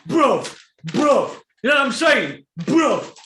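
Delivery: loud, high one-word outbursts; exasperated shouting. Real, not acted. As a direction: fire off each word in loud disbelief. Exclaims in disbelief at his rival.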